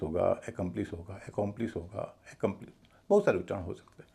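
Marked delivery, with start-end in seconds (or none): 0.5-0.9 एकम्प्लिश with a clear "e" start, "e-kum-plis"; full ए opening, short middle vowel in एकम्प्लिश; candidate pronunciation. Shown as a demonstration. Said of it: "इसका उच्चारण क्या होगा". 1.3-1.8 एकम्प्लिश as "e-KOM-plis", rounded middle vowel; full e and o in एकम्प्लिश; candidate pronunciation. Shown as a demonstration. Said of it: "इसका उच्चारण क्या होगा".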